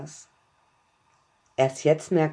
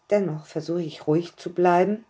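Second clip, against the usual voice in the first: plain reading voice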